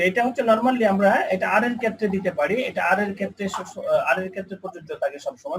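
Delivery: usual speaking voice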